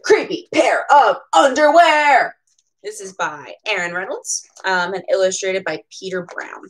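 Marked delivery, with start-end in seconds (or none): 0.0-2.4 dramatic title. Acted. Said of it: "title read again".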